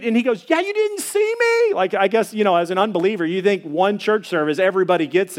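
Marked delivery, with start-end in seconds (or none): 0.5-1.7 very high, surprised question; can't believe he missed it; surprised neighbor. Retold or imitated.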